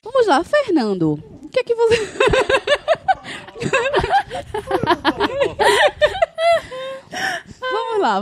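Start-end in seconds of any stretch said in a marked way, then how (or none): 1.4-2.0 high squealing laugh while speaking; can't hold back laughing; laughing hard. Real, not acted. Breaks into laughter. 2.0-7.6 high, teary laughing fit; squealing laugh. Real, not acted. 7.6-8.2 high squealing laugh while speaking; can't hold back laughing; laughing hard. Real, not acted. Breaks into laughter.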